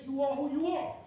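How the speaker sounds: ordinary talk, nothing marked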